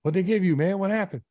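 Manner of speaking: high, nosy holler; calling out. Retold or imitated. Inmate questioning his neighbor back from the committee, high calling out. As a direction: imitate a neighbor hollering for news. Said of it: "don't yell over here".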